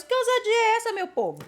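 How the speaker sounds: high, loud indignant outcry. Real, not acted. Preacher asking her people what audacity this is, indignant. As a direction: demand it of the congregation, appalled. Indignant rhetorical question to congregation.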